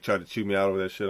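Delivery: usual speaking voice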